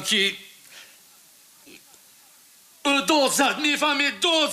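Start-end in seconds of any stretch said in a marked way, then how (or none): 2.8-4.5 agitated recall. Acted. Suspect relives the scream.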